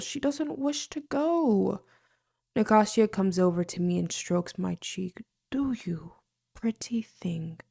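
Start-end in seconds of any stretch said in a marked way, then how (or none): 0.0-1.8 high, slow, teasing fairy voice; a fairy lady teasing the servant in a high lilting voice; sly fairy coo. Acted. Fairy woman teases the servant. 5.5-7.6 purring whisper. Acted. Fairy strokes her cheek.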